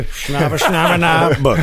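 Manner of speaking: hammed-up fake Chinese; fake Chinese accent. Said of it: "That was the worst Chinese accent".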